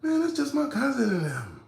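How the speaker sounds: high, casual put-on woman's voice; high imitation of her shrug; high mock girl voice. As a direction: toss it off high and breezy.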